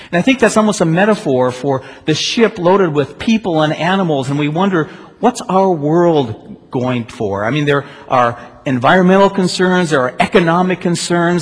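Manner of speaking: loud, rising pulpit voice; fervent preaching. Acted. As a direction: preach it with growing urgency. Impassioned preaching build.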